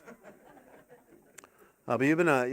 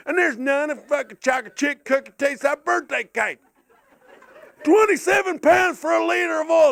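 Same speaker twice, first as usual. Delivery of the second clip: mock rant; 8 semitones higher than usual. Ranting at petrol station.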